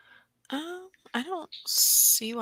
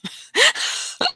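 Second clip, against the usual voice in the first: high giggle; 8 dB louder than usual. Real, not acted. Co-host giggling after both said ooh together. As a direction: burst of high, loud giggling.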